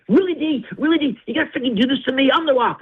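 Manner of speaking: mock The Rock as a petulant star complaining; whiny Rock impression. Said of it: "And he's like".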